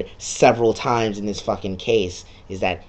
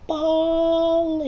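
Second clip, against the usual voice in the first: very high, exaggerated cheer; hype. Real, not acted. Gamer wrapping up the first case with a high, ironic cheer. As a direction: squeal it like a sarcastic hype man.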